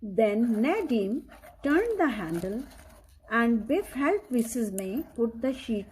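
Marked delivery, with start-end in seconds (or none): none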